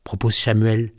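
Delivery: usual speaking voice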